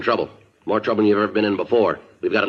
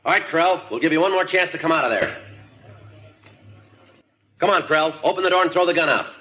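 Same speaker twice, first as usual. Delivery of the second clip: shouting through a door; calling out; 12 semitones higher than usual. Acted.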